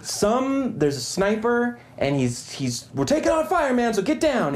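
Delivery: flustered soldier. Retold or imitated. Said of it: "he's like".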